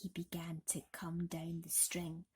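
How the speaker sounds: breathless suspense, barely voiced; whispered suspense; hushed whisper. A narrator's reading. Tense narration of slow descent.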